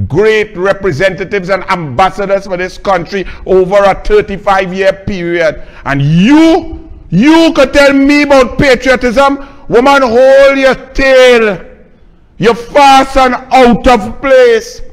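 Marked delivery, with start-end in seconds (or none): 5.8-14.8 higher, louder, slow and cutting; furious scolding. Real, not acted. Telling the President to hold her tail, furious scolding. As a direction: put her in her place with contempt.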